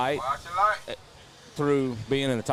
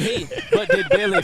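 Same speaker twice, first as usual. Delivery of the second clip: high laughing question; cracking up while asking; 6 dB louder than usual. Real, not acted.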